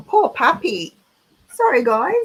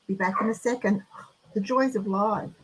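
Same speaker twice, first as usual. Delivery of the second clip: ordinary talk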